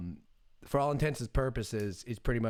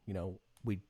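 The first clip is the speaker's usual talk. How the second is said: ordinary delivery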